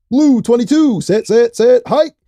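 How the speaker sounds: high, loud snap count; mock quarterback call. Podcaster tossing out random numbers, mock quarterback call.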